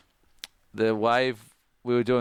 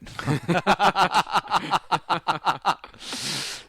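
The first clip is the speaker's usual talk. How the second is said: a high, bursting laugh; big laugh; 8 semitones higher, 3 dB louder than usual. Real, not acted. Laughing at the captain's dare, real big laugh.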